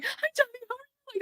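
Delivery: tiny high squeal, soft; mock fangirl squeak, very high and small; giddy little kid. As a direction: imitate a bouncing fan squeaking hello. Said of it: "like a little ball of energy".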